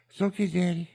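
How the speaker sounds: put-on high, trusting child voice; little boy. Five-year-old boy answering his father, high and trusting. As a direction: play a small boy agreeing to his dad.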